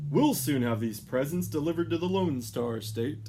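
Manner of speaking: loud, cheerful Santa voice. A narrator's reading. Santa promising deliveries in Texas, loud and cheerful. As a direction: proclaim it with gusto.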